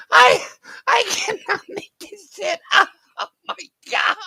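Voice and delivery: high, crying with laughter; laughing, near tears. Real, not acted. Streamer to chat, crying with laughter. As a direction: shout it out through tears of laughter.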